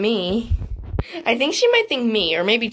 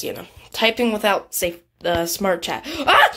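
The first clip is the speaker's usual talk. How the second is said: ordinary delivery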